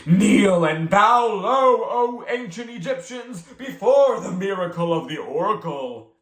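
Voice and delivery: thundering command. Acted. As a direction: order them down like a high priest.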